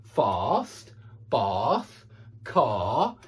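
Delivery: "fast", "bath", "car" with a long open AR vowel. Shown as a demonstration. Teacher demonstrating the AR vowel in fast, bath, car. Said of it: "I can do this sound".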